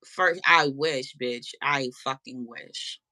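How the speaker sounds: slow, drawn-out longing; slow dry complaint; slow sarcastic wish. Real, not acted. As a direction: drag each word out wistfully. Wishing for escalator.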